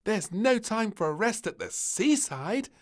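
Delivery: put-on high, bossy child voice; eager little princess. A narrator's reading. Princess urging the tired family on, high and bossy. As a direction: insist cheerfully.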